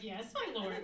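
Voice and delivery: exaggerated mock obedience; sarcastic. Wife answering her swearing husband, sarcastic. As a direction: swoop down through a fake-submissive reply. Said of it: "it would be rife with sarcasm".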